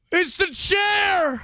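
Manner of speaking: high, slow, loud shout. Real, not acted. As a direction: shout it out, stretching each word. Shouting reaction to the clip.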